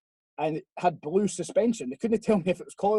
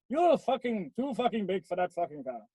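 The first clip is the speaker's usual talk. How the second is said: put-on Welsh accent for a quoted friend; mock Welsh accent; 3 semitones higher than usual. Telling Mike he's too big for the car, mock Welsh accent. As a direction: imitate him in a hammy Welsh lilt. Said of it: "so I'll do a Welsh one instead".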